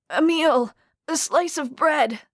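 a starving man's cry; begging. Acted. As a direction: beg for food at the window. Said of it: "the man begged".

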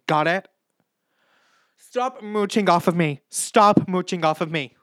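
high, loud repeated orders; reality-show row shouting; angry shouting. Retold or imitated. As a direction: shout them off your back. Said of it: "This is from Big Boss".

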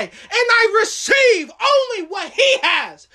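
shouted high preaching climax; roaring rallying speech. Acted. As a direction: roar it out like a revival. Climax of sermon, shouting declarations.

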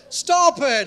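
firm command. Retold or imitated. Jesus voiced in a retold story.

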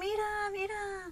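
high-pitched delighted urging; excited mom. Retold or imitated. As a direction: coo it insistently, imitating mom. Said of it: "Why is she so excited?".

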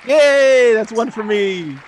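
high, loud "yay" of victory; real whoop, easing down; gleeful cheer. Real, not acted. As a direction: celebrate a point scored. Celebrating winning the first spin.

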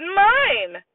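defiant shout. Acted. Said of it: "Fudge shouted".

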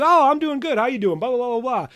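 mock cheery client. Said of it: "And then it goes".

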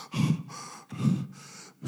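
a sharp unvoiced gasp for air; breathless gasp. Man fleeing an attacker gasps at the door, breathless. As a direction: gasp as if just stopped running.

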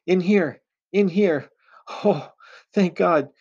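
high, loud, urgent call from down the hall; muffled cry for help. A narrator's reading. Captive woman calling to her rescuer, high urgent cry. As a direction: cry out high in desperate relief. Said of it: "came a muffled voice from down the hall".

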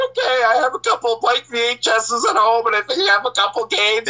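high, pleading confession; broken teen confessing to the cop, high-pitched; confessing scared. Retold or imitated. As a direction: give it up like a scared kid. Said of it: "And I'm like".

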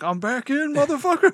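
high, loud gleeful yell; excited shout. Retold or imitated. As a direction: shout it back in triumph. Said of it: "Mike's like".

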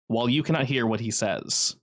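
ordinary talk, nothing marked

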